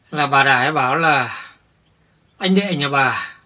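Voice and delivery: loud, heated grievance; upset and indignant. Acted. Husband loudly vents what Bà Đài told him, indignant. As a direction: vent the betrayal at full volume. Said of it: "tôi vẫn đang còn rất là bức xúc".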